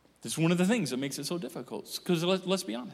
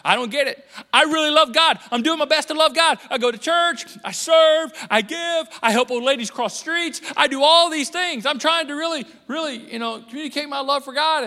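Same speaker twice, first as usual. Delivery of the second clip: high, loud mock protest; hammed-up churchgoer complaining to God; frustrated believer; 7 semitones higher, 6 dB louder than usual. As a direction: list your good deeds indignantly.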